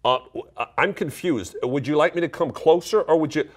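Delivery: high, loud, confused American; standard American accent. Acted. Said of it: "sorry for the standard American accent every time I do it".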